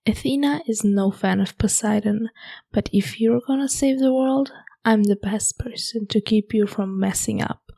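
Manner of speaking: ordinary talk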